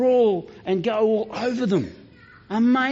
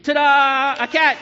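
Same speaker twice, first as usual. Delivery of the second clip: louder, higher magician's ta-da; ta-da reveal; 8 semitones higher, 6 dB louder, slower than usual. Acted. Pretend magician presents the girl turned cat, excited. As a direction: announce the trick like a showman.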